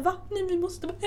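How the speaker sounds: high-pitched mock panic; retold panic. Traveller retelling being told to stay in Paris, retold panic. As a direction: act out panicking, half laughing. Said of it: "Typ så här, panik".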